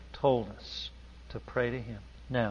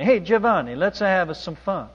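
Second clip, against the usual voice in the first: put-on Italian accent; 6 semitones higher, 8 dB louder than usual. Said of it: "Luigi said to Giovanni".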